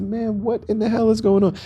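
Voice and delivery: stunned, almost squeaking; alarmed outrage. Retold or imitated. To the doctor undoing his pants, high alarmed disbelief.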